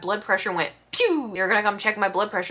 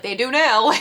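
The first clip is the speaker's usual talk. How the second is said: punchline reveal; 5 semitones higher, 8 dB louder than usual. Retold or imitated. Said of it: "and I was like".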